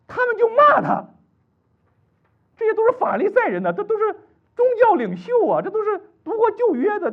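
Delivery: high, loud, impassioned sermon; fiery preaching. Acted. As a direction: preach it at full force. Preaching at full force. (高亢激昂的讲道)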